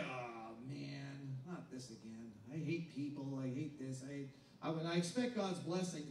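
low, muttered churchgoer complaint; soft grumbling believer; grudging mutter. Retold or imitated. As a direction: mumble it like someone dragging to church.

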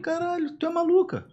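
very high, hushed incredulity; mock disbelief. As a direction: squeak it out, aghast at mum. Son's disbelief at mother, retold.

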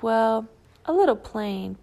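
usual speaking voice

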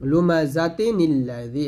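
slow লুমাজাতি নিল্লাযী run together; slow tajweed joining demo; joined recitation. Shown as a demonstration. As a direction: link লুমাজাতি into নিল্লাযী slowly. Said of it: "এক জের উচ্চারণ করব".

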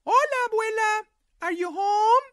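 put-on high, loud girl calling; loud high girl's call. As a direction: call into the house for grandma. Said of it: "she called".

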